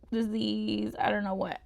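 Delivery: ordinary delivery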